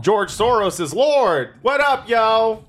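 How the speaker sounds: jokey drawn-out proclamation; mock hype shout-out. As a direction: proclaim it like a goofy hype man. Mock hype shout-out after teasing.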